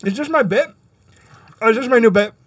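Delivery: talking with Pop Rocks crackling; popping candy in mouth. Real, not acted. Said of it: "the sound of my voice popping like this".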